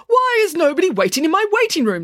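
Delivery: affronted, high-pitched outburst; indignant demand. A narrator's reading. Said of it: "demanded the doctor".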